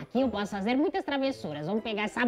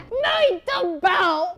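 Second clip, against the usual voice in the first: high laughing, drawn out very slow muito bom; mischievous laugh; 9 semitones higher, 4 dB louder, much slower than usual. Real, not acted. Rocket ruins the couple's date, high slow laughing delight. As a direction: savour the prank through laughter.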